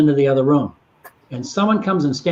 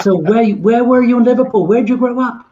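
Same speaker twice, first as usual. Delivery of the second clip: much higher, louder, rapid-fire eager questions; 8 semitones higher, 4 dB louder than usual. Retold or imitated. [name] quizzing the wife about Liverpool, excited and higher. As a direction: pepper her with excited questions.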